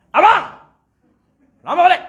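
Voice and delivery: very high, loud nagging yell; wife yelling. Retold or imitated. Wife yells at her husband for coming home late, loud scolding. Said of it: "paling kuat suara".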